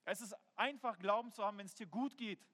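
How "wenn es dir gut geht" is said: ordinary talk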